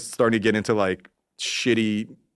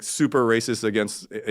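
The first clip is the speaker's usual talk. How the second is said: normal talking voice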